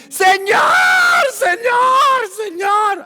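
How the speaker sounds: high, loud desperate cry to the Lord; woman begging Jesus, calling out loud. Acted. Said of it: "empezó a clamar, empezó a gritar".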